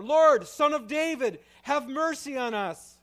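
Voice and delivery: very loud, high, slow desperate shout. Acted. Blind men shouting over the rebuking crowd, very loud cry. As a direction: shout over the crowd trying to silence you. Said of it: "they shouted all the louder".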